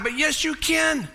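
high, slow "But yes, you can"; emphatic answer. Acted. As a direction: lift it high and insist. Answering the doubt.

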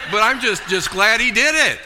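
real laughter breaking into the words; laughing. Real, not acted. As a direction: laugh it out gladly. Laughing through joy.

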